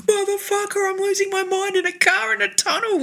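high panicked outburst. Retold or imitated. Losing it alone in the car tunnel, high and panicky. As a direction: freak out in the tunnel. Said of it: "I'm like".

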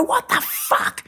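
high, drawn-out strained screech; retold furious outburst. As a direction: act out waking up furious, voice cracking high. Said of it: "I'm furious 'cause I've woken up fighting".

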